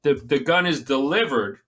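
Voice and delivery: ordinary talk, nothing marked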